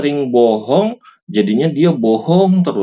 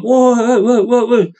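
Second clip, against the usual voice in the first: put-on high, excited kid shouting wah; high-pitched gleeful child yelling during Quran class; rowdy kid; 11 semitones higher than usual. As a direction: mimic a noisy santri having fun.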